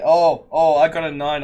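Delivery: loud, thrilled exclamation; sudden loud delight; excited dice reaction. Real, not acted. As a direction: burst out over a good roll. Player reacts to dice roll.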